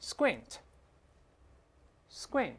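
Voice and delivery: squint slowly with k softened to g; correct soft k. Shown as a demonstration. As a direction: model squint twice as "sgwint", long pause between. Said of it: "这个k轻轻发成个这个声音".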